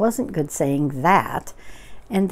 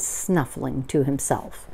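ordinary delivery

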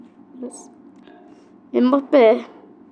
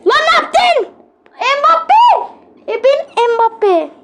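much higher, loud shrieked outcry; frustrated scream; 13 semitones higher, 4 dB louder, much slower than usual. Real, not acted. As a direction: scream at the player in despair. Screaming at player's mistake. (oyuncuya çığlık atarak)

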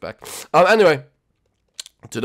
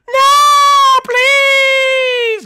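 very high, loud drawn-out wail; desperate pleading; 7 dB louder than usual. Acted. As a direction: wail for mercy like a banished recruit. Expelled recruit begs.

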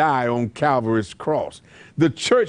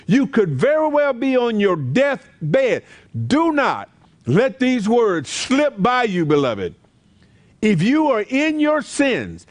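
higher, urgent preaching at full force; fiery preaching; 6 semitones higher than usual. Acted. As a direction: warn the listener like an evangelist. Urgent altar call warning.

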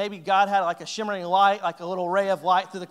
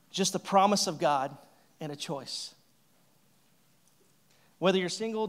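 ordinary delivery

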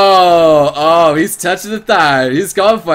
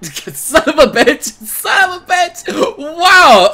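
high, slow, stretched-out disbelief; shocked outburst; 11 semitones higher, 3 dB louder, much slower than usual. Real, not acted. Streamer shocked by the game's innuendo, high disbelief. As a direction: squeal the curse, then burst out loud. Shocked by suggestive game voice acting.

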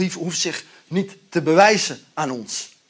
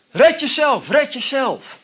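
mock taunt; 9 semitones higher, 6 dB louder, slower than usual. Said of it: "En red mij er dan ook even bij".

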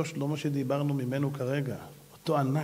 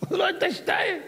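laughing mockery. Mocking the two-headed man.

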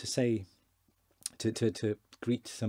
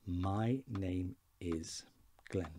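slow translation; much slower than usual. Shown as a demonstration. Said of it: "So it is".

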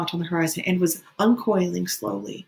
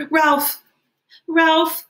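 high, loud cry of a name; sudden high-pitched yell; pained cry; 11 semitones higher, 7 dB louder than usual. A narrator's reading. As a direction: cry out as though hurt. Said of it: "Simon cried out as though".

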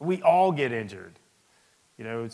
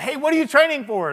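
mock brusque officer, higher and louder; imitating a major; 12 semitones higher, 8 dB louder than usual. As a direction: bark it like a curious major. Major questioning him, retold.

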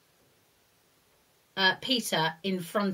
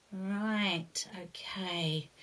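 soft whispered aside; whispering; 6 dB quieter, much slower than usual. Real, not acted. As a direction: whisper it to yourself.

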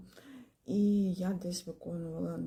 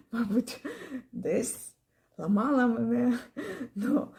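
higher, louder, through real laughter; laughing; 5 semitones higher, 6 dB louder than usual. Real, not acted. Student fondly recalling how hard the teacher pushed her, laughing. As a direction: let laughs break up the words.

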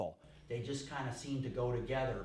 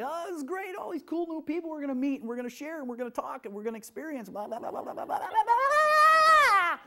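high, gushing, runaway chatter rising to a squeal; fiery giddy overexcitement; 7 dB louder than usual. Retold or imitated. Teacher mocking her own fiery overexcited self in a high gushing voice. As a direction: bubble over like you can't contain it. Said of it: "'cause I'm all fiery and like".

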